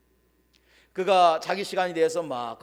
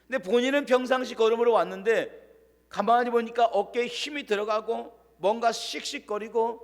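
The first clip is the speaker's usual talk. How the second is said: usual speaking voice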